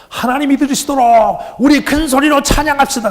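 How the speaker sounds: mimicked worship leader, high loud rallying call. Praise leader urging the congregation to sing loudly, high mimicked shout. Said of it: "찬양 인도하는 사람이 그러더라고요".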